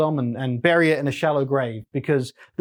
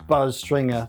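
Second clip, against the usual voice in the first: ordinary delivery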